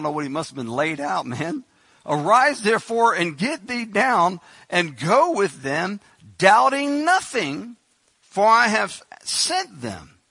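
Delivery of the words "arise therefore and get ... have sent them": loud, slow divine command. Acted. Spirit ordering Peter to go with the men, loud and slow. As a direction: drag it out as a heavenly order.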